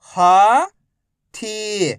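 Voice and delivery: each syllable slowly held, first one rising. Shown as a demonstration. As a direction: break the word into long, separate syllables. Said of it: "एक अक्षर का उच्चारण करके".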